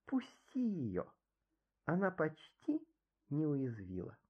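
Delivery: very soft, gentle plea; pitying. A narrator's reading. Revived Lizetta asks her maid to free the bee, soft pity. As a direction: ask softly for mercy, with tender pity. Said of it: "жаль пчелы".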